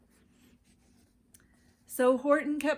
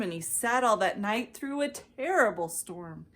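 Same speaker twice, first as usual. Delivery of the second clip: ordinary delivery, nothing marked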